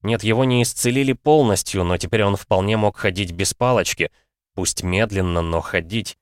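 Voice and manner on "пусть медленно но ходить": ordinary delivery, nothing marked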